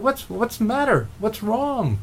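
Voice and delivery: high, worried asking; anxious question to a crying man; concerned. Retold or imitated. Old man starts to cry.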